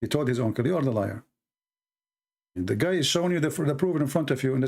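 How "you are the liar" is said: usual speaking voice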